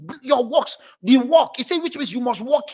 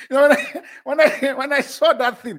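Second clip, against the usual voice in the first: talking through real laughter; giggling, higher and louder; laughing; 5 semitones higher, 7 dB louder than usual. Real, not acted. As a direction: laugh while you tell it. Laughing at the story.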